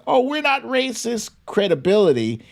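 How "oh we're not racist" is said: mocking GOP voice. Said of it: "to lend their".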